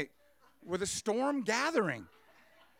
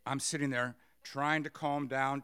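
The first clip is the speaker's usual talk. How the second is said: ordinary talk, nothing marked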